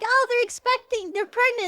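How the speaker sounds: high, loud gushing cheer; gushing women. Retold or imitated. Women cheering the pregnant travellers, high and loud. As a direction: clap and coo like thrilled villagers. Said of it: "the women are clapping like".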